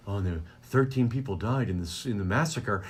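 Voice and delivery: low, soft, somber anchor voice; a grave newsreader delivering tragedy; mock news anchor. Mocking serious news anchor.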